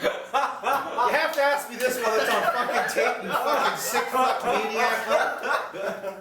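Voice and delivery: high and loud, slowed, laughing; laughing mock outrage. Real, not acted. Mock-scolding his friend for the question, laughing loudly. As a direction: scold him while cracking up.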